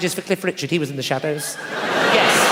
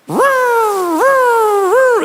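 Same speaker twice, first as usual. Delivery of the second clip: high, loud rising woo; car noise imitation; 14 semitones higher, 7 dB louder, much slower than usual. Customer to mechanic, mimicking loud car noise. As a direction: wail like a faulty engine. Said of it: "It keeps going".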